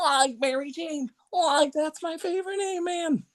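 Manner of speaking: high, laid-back Shaggy voice; Shaggy impression. Shown as a demonstration. Doing a second Shaggy line for his co-hosts, high and easy. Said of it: "a nice blend of the original Casey Kasem with Lillard".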